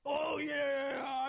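high, loud gloating whoop; mock celebration shout. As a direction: ham up the overexcited fans. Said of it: "they're all jumping up and down".

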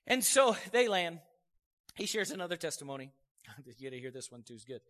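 ordinary talk, nothing marked

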